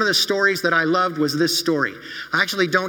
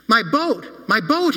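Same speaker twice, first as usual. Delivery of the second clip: dismayed; 5 semitones higher, slower than usual. Acted. Boy sees boat snap away.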